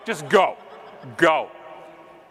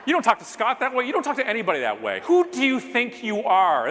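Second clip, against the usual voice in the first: mock outrage; 9 semitones higher than usual. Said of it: "And I'm like".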